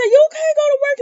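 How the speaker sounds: very high, loud put-on old man voice; hammed-up forbidding order; mock bossy elder. Victor forbidding Sonny to go to work, hammed-up.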